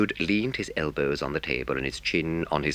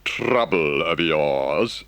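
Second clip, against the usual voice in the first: slowly and impressively; 6 semitones higher, 6 dB louder, much slower than usual. Acted. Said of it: "said the Rat slowly and impressively".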